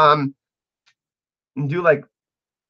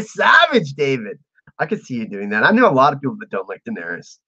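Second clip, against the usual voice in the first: usual speaking voice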